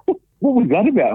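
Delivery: high-pitched imitation of a puzzled friend; baffled question. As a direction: act out a bewildered mate asking. Acts Dean's baffled question.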